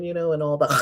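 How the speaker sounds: ordinary talk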